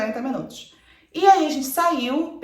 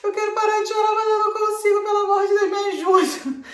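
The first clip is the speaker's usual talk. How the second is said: much higher, louder mock desperate begging; retold crying bride, pitched way up and shouted; tearful plea; 11 semitones higher, 6 dB louder than usual. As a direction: replay the frantic plea through tears, half laughing at herself. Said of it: "eu falava".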